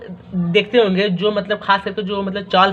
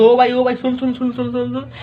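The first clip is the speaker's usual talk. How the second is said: imitated pestering student; 4 semitones higher than usual. Teacher acting out students stopping him mid-lesson, higher, pestering calls. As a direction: imitate a student trying to get a word in.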